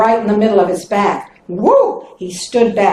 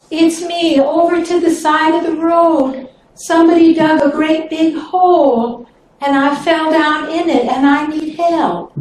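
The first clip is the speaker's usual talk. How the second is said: higher, wheedling snake voice; a raised, coaxing plea for help; pleading snake; 6 semitones higher than usual. Acted. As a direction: beg sweetly from the hole.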